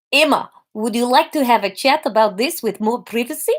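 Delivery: lightly put-on Russian doctor; concerned doctor with a Russian accent. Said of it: "We'll go for a Russian doctor".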